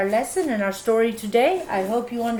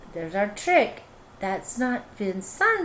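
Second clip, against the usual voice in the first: ordinary delivery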